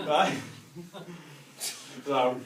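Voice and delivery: usual speaking voice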